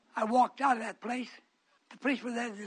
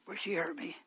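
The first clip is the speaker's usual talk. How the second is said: ordinary delivery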